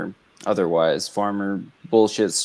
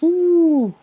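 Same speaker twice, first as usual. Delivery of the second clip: sudden high ooh, much louder; real surprise; 7 dB louder than usual. Real, not acted. Co-host reacting to the Sharpie note story, high surprised ooh. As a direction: gasp it out, caught off guard.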